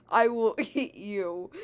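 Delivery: slow, loud creature voice; monster threat. A narrator's reading. Punched chomp threatens Luigi, slow hammed-up menace.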